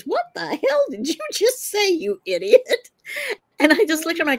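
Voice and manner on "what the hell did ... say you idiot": high-pitched, appalled talking to oneself; inner self-scolding. Retold or imitated. Woman to herself after accosting a man, appalled. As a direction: scold yourself in a raised voice, aghast. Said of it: "having my own internal dialogue".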